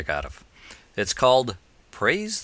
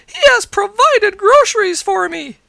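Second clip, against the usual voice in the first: put-on high old lady voice, loud and slow; joyful cry; 10 dB louder than usual. A narrator's reading. Old lady thanking the Lord for groceries, high shout. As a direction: proclaim it loudly in a high voice. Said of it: "she cried".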